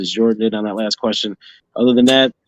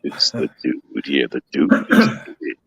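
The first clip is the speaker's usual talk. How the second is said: the dude's voice; 8 semitones lower than usual. Acted. Host announcing the dude is in, low dude voice. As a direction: drop way down and stretch out the words. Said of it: "his voice sounds like this when he says".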